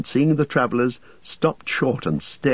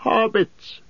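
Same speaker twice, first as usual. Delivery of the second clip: sudden cry; 14 semitones higher than usual. A narrator's reading. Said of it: "he cried".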